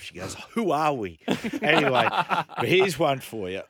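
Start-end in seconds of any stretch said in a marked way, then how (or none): 0.5-1.2 amused quote. Retold or imitated. Wife's quote, laughing. 1.2-3.6 laughing. Real, not acted. Laughing after story.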